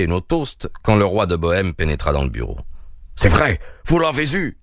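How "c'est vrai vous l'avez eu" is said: higher, eager outburst from the king; excited cry. A narrator's reading. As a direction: burst out with hope. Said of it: "cria-t-il".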